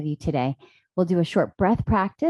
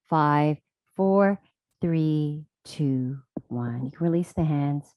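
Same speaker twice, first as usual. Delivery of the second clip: guided meditation; 2 semitones lower, slower than usual. Real, not acted. Yoga teacher closing a breath practice, calm meditative guidance. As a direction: count them down gently, then leave long pauses. Last breath round, then quiet noticing.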